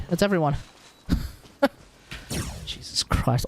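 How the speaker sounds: ordinary talk, nothing marked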